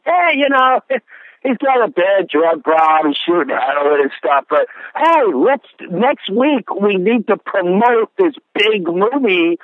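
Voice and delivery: high, breezy mock voice of careless industry people; mocking showbiz types. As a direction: toss it off like a shallow producer who only cares about the movie.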